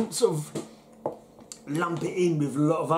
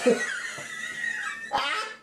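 shrieking laugh; 3 dB quieter than usual. Real, not acted. Drunk joke lands.

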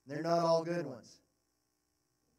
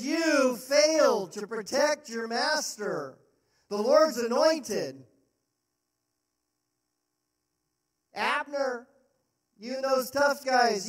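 David rebuking Abner; loud rebuke; 5 semitones higher, 6 dB louder than usual. Acted. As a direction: condemn him for failing the king.

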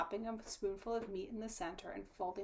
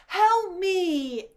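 high, very loud plea for help; crying out; 10 semitones higher, 16 dB louder than usual. A narrator's reading. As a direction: cry out urgently to the others. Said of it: "Maria cried".

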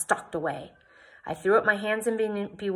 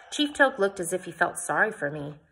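ordinary delivery, nothing marked

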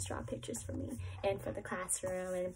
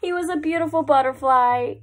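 louder, higher, beaming reveal; excited reveal; 4 semitones higher, 13 dB louder than usual. Acted. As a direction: announce it like the grand surprise. Big reveal of the story.

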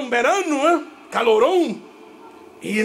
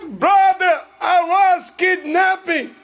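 hammed-up victim; 8 semitones higher, slower than usual. Sister tells her brother she was kidnapped, hammed-up alarm. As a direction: play up the drama of a fake victim.

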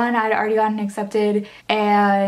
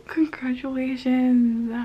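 whispering; 2 semitones higher, 3 dB quieter, slower than usual. Real, not acted. Reading acceptance aloud.

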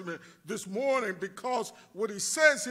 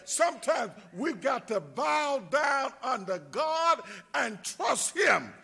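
preaching call; 4 semitones higher than usual. Acted. Call to trust God.

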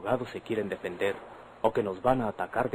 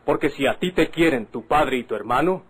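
louder, hurling the words back at her; proud, loud defiance; defiant raised voice; 4 semitones higher, 8 dB louder than usual. Acted. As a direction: throw his father's name at her like a threat. Defiant boast during the quarrel.

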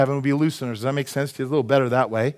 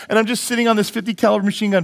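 normal talking voice

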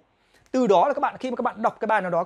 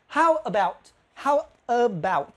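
slow "how about", the "about" kept short; slow classroom model; slow demo; slower than usual. Shown as a demonstration. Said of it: "đọc ngắn thôi, about".

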